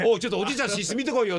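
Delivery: half-serious urgent order about grandpa; mock alarm. Acting out worry about senile grandpa.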